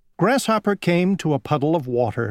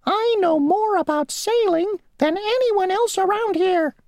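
very high, squeaky and self-important; a tiny insect bragging; the mosquito bragging to the grasshopper; boastful mosquito. Acted. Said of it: "said the mosquito".

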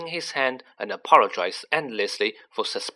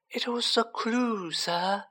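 high, slow elf voice; earnest elf; 10 semitones higher, 4 dB quieter, slower than usual. A narrator's reading. Dobby explaining the clue to Harry, earnest and high. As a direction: explain it high and slowly, as if obvious. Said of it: "said Dobby, his eyes widening".

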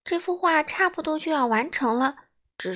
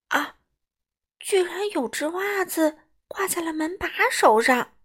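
higher-pitched storybook astonishment; acted surprise with lifted pitch; surprised; 6 semitones higher than usual. A narrator's reading. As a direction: gasp "ah", then exclaim in rising wonder. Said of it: "他惊讶地说".

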